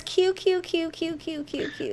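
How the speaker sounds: repeated Q trailing off to silence; fading mock echo. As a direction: echo Q, fading away each time. Mocking repetition fading out.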